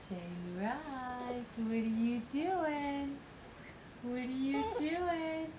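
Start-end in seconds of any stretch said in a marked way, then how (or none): none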